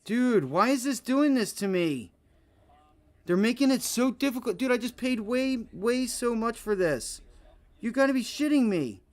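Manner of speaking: high, loud, frustrated outburst; exasperated. Real, not acted. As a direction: vent your disbelief at the chart. Losing money on a trade.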